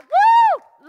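high, loud celebratory whoop; joyful whoop. Real, not acted. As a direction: let out a big joyful woo.

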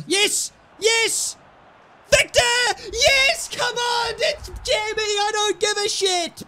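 very high, loud screaming yes; roaring celebration, voice cracking high; wild goal celebration. Real, not acted. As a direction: lose it completely over the goal.

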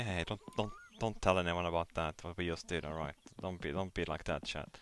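hushed. Real, not acted. Asks chat to keep secret.

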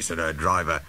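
plain reading voice

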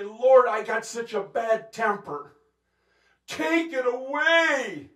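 loud, high cry to the Lord; acted desperate appeal, voice pushed up high; pleading prayer. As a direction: beg loudly, stretching out the plea. Acted desperate prayer about temper.

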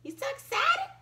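high, loud burst of excitement; excited. Acted. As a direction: squeal it out with glee. Excited dragon.

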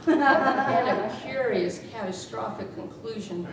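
ordinary talk, nothing marked

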